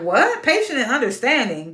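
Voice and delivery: stunned mock repeat of patient and understanding; incredulous. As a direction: throw his words back in disbelief. Mocking TJ's claim.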